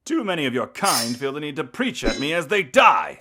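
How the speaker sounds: high, loud villain's scorn; theatrical scorn. Acted. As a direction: sneer loudly at their preaching. Vampire mocks her dying hunters.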